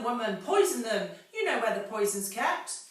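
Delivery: exasperated, laughing insistence; loud order with a laugh. Acted. Mrs Bean repeating order.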